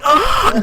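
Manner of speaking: high, loud mock shriek; imitating a startled girl's shriek; mock scream. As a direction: squeal like a startled girl. Retold girl screaming.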